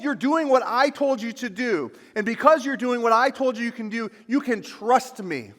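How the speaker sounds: loud, high fervent preaching; preaching at full force. Acted. Pastor to congregation, fervent loud preaching. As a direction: preach it out with fire.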